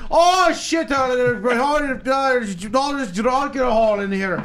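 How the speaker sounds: high, loud mock Irish drunk rant. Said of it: "They're like".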